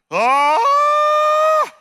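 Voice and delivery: long, very high, loud scream; terrified mock scream. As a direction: scream as if careering off alone. Child suddenly riding alone, screaming.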